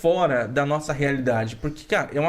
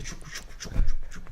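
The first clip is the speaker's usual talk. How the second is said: unvoiced tchucu tchucu of a bike; riding home on the bike, imitating its rhythmic tchucu; pedalling sound effect; faster than usual. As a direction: make the bike's pedalling noise with your mouth.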